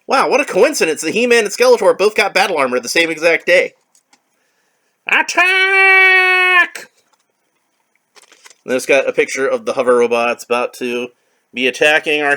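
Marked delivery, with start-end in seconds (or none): 5.1-6.9 very high, loud shrieked order; shrill villain yell; villain's command. Acted. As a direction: scream the attack order.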